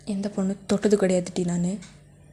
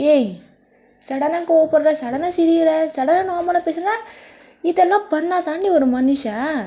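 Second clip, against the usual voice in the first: much higher and louder, every சடனா yelled; angry shout; 7 semitones higher, 5 dB louder than usual. Acted. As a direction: explode on each சடனா. Boyfriend yells the same list louder. (சடனா கத்தி சொல்வது)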